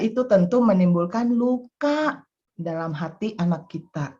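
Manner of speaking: ordinary delivery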